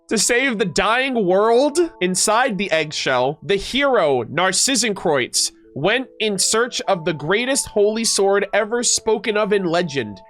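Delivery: deliberate, dramatic heroic reading; epic legend narration. Acted. Streamer reads the hero's quest to chat, epic narration. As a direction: build it like a grand legend.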